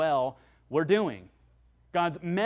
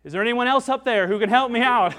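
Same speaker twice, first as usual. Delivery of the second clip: loud, higher and fast desperate shout; shouted back; 6 semitones higher, 11 dB louder, faster than usual. Acted. Man on the branch refuses to let go, shouting loud and fast. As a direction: yell it up in comic panic. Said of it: "shouted back".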